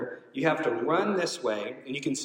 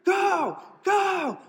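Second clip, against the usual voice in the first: very high, loud shouted cheering; letting loose cheering; 4 dB louder, much slower than usual. Retold or imitated. Friend at the finish line screaming for the runner, very high loud shouts. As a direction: scream encouragement at a tired runner. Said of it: "she is just letting loose".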